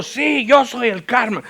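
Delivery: high, loud, drawn-out mock retort; hammed-up indignant shout; imitated angry yelling. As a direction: play the irate driver insisting, slowly. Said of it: "me empezó a gritar".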